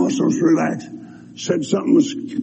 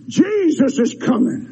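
ordinary talk

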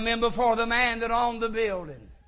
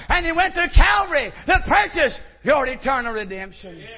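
full-force preaching; 5 semitones higher, 6 dB louder than usual. Acted. Preacher urging the congregation to salvation, full-force preaching. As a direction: preach it to the rafters.

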